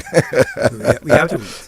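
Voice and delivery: laughing through the words; chuckling while speaking. Real, not acted. Laughing at the caller's suspicion.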